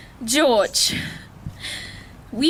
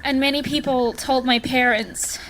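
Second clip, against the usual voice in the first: usual speaking voice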